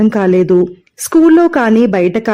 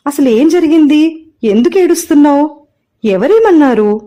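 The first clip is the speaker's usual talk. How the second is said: higher, louder anxious questions; anxious mother; 7 semitones higher, 5 dB louder than usual. Acted. Mother begging crying daughter to explain, anxious and loud. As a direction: press her urgently, worried.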